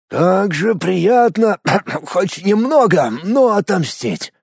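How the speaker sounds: slow, gloating villain voice; satisfied drawl. A narrator's reading. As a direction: savour every word of revenge. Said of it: "удовлетворённо протянул некромант".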